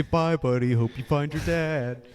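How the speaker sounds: a lightly voiced cartoon goodbye; mild narwhal goodbye. Repeating the narwhal line he says randomly, lightly voiced. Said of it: "I'll just say".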